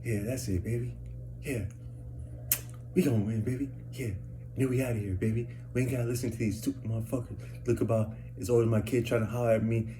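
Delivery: imitating her soft murmur to a ticket; hushed, retold talking to herself; woman coaxing lottery ticket. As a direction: murmur privately as if cheering on a scratch-off. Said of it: "that's what she said".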